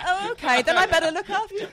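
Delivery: high, loud, through laughter; laughing mock acceptance. Imitating gullible peacock owner's acceptance, laughing. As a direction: rush the quote while cracking up.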